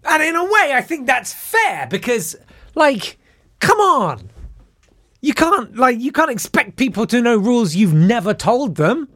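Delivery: high-pitched, exasperated protest; indignant protest. Real, not acted. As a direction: argue it in a high, incredulous voice. Indignant argument about unfair rules.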